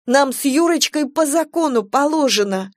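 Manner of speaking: high, indignant insistence; heated young wife's protest; starting to seethe. A narrator's reading. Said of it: "Кира начала закипать".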